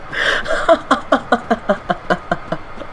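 long breathy laughing fit; helpless, mostly voiceless laughter; soft wheezing laugh that runs on. Real, not acted. Amused by the game.

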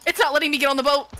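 panicked. Real, not acted. Panicked gamer stuck at boat.

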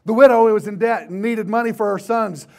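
fired-up pulpit storytelling; forceful preaching. Real, not acted.

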